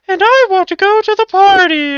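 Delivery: put-on very high, loud squeaky girl voice; an old man squeaking out a little girl's line. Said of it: "the lines of some little girl".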